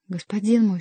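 usual speaking voice

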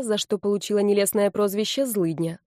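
ordinary delivery

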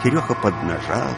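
ordinary talk

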